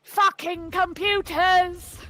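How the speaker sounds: slow, dragged-out exasperated curse. Real, not acted. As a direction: drag out the curse in frustration. Joining the rage at computer.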